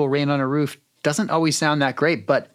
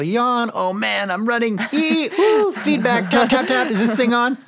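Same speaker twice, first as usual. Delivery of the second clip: higher, louder quick replay of her effects; imitating excitedly; 10 semitones higher, 4 dB louder than usual. Sound designer imitating the host's effects, excited mock replay. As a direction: bounce through the sounds like a kid. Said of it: "That's how my brain is".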